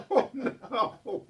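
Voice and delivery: high laughing oh no; burst of laughter. Real, not acted. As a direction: crack up and gasp oh no. Laughs at child's insult.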